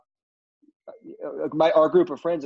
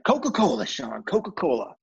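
Gary Busey impression; 3 semitones higher than usual. Actor tells the new assistant his drink order, in a Gary Busey voice. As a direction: do Gary Busey asking for a Coca-Cola. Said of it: "in typical Gary Busey".

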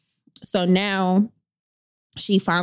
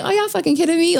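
outraged disbelief; 11 semitones higher, faster than usual. Retold or imitated. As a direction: throw the question at them. Outraged retold thought.

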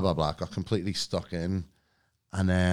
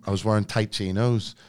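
ordinary talk, nothing marked

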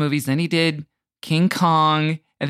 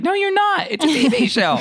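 mocking retort; 13 semitones higher, 4 dB louder than usual. Mock reply to showrunners.

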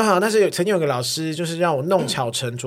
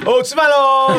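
high, loud cheer for lunch; mock lunchtime cheer; 8 semitones higher, 7 dB louder, slower than usual. Kid cheering that lunch is here, excited. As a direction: sing out the lunch call. Acting himself greeting lunch.

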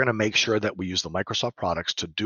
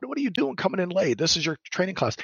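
higher, retold scolding of a latecomer; mock scolding; 10 semitones higher than usual. Teacher retelling how she scolds a late veteran, mock scolding, higher. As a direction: play-act calling out a late student. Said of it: "and I was like".

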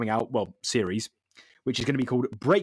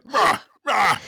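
effort grunt; 8 semitones higher, 7 dB louder than usual. Retold or imitated. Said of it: "You're like".

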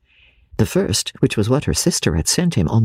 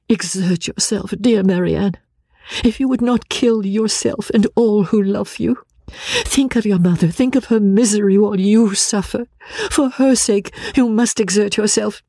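higher, urgent sisterly appeal; pleading Eleanor; 10 semitones higher than usual. Acted. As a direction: implore her to pull herself together.

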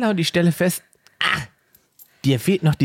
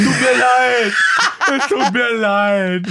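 mock apology; 7 semitones higher, 4 dB louder, slower than usual. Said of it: "dann sagst du".

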